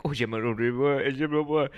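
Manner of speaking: imitated French couple's babble; mock French. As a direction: run French-sounding nonsense together. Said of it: "there's this French couple in front of us".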